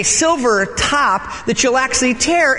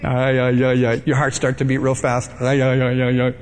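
nervous ay ay; 10 semitones lower than usual. Retold or imitated. Panic at doing wrong.

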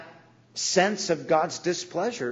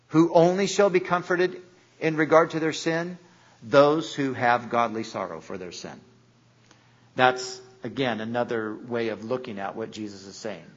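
usual speaking voice

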